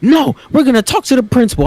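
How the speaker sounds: high-pitched imitation of mom; insisting mom. Mom telling her kids not to fight, high insisting imitation. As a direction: mimic a mother overruling her kids.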